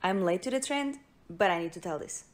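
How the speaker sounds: ordinary talk, nothing marked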